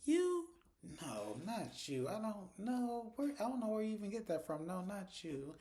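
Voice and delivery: high, drawn-out disbelief; mock-shocked squeak; Sabrina, incredulous. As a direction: stretch it slowly as if the question is absurd. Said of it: "Sabrina was like".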